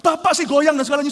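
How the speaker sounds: loud, high mock scolding; retold scolding. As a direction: imitate the angry barber blaming a customer. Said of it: "Dia marahin, dia bilang".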